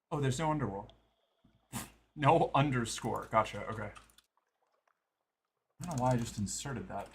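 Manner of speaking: ordinary talk, nothing marked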